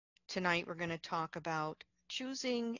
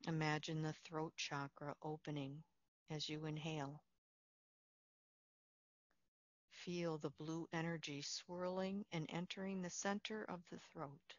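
soft, unhurried meditation voice with long pauses; guided meditation; 4 dB quieter than usual. Real, not acted. Leads a Yoga Nidra relaxation.

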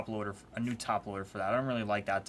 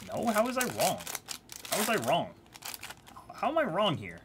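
indignant protest; 7 semitones higher than usual. Real, not acted. Protesting to chat, high pitch.

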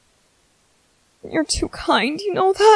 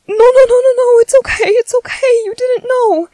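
frantic reassurance; 7 semitones higher, 4 dB louder than usual. Acted.

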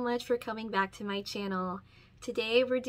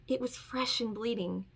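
ordinary delivery, nothing marked

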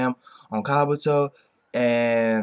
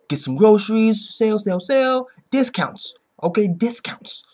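higher, louder, eager chant of bargains; excited about the sale; 8 semitones higher, 4 dB louder than usual. Retold or imitated. Hero dreaming of the grocery sale, eagerly chanting. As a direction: savor each word like a shopper dreaming of deals.

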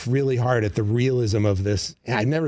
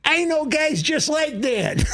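put-on slow, much higher shout in a Chicago accent; Chicago fan shouting. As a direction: bellow it like an indignant local. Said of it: "it's like".

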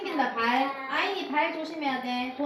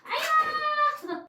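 mock ouch; 6 dB louder, much slower than usual. Mother plays hurt by child.

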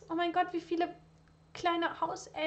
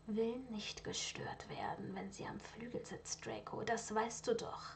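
cold whisper; 11 dB quieter than usual. Acted. Lucius coldly rebuffs son.

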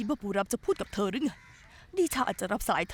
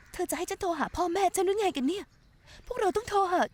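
agitated outburst; 9 semitones higher than usual. Acted. Heated argument about whom to call.

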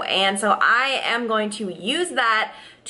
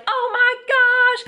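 mock exclaim; 5 dB louder, slower than usual. Running out to the cop.

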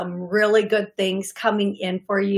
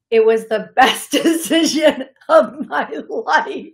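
a louder quote broken by a laugh; laughing through a quote; 3 semitones higher, 4 dB louder than usual. Retold or imitated. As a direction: relay her words while cracking up. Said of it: "I'm laughing".

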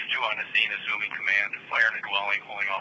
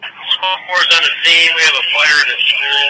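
firefighter command; 9 dB louder than usual. Real, not acted. Urgent fireground radio report.

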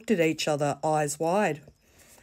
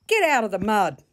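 firm, louder, higher order; stern auntie; 5 semitones higher, 5 dB louder than usual. Acted. Auntie Pearlie ordering the girls out of the mud, stern and loud. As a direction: snap the command at the kids. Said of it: "she said sternly".

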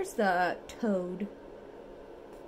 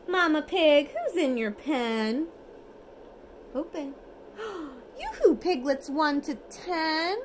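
lively storybook reading, sing-song; reading to children; 5 semitones higher, 4 dB louder than usual. Acted. Parent reads bedtime picture book to toddler, lively sing-song. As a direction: read it brightly for a toddler at bedtime.

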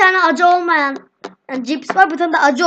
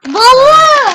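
shocked cry; 8 semitones higher, 7 dB louder, slower than usual. Real, not acted. Reacting to hot chip.

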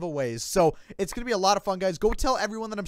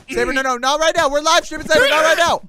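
high, loud mock reprimand; mock scolding; 8 semitones higher, 9 dB louder than usual. Telling Saber off on the live stream, loud and high. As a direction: scold him like a stern parent.

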